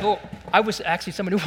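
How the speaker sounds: usual speaking voice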